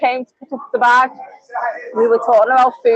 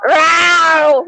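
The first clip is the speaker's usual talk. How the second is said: long drawn-out "row" noise; imitated cry; 3 semitones higher than usual. Old Spanish lady at a tattoo, imitated "row" cry. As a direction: mimic an old lady's odd cry. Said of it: "she was going like this".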